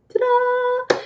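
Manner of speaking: high sing-song flourish; playful fanfare. Pretend. Author unveiling her next book to viewers, playful high fanfare. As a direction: present it with a little high-pitched fanfare.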